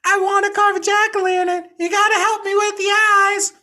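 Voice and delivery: put-on very high, loud child calling from a window; screaming kid. A narrator's reading. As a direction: yell it up high like an excited little boy. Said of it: "His son Billy opens the window and screams".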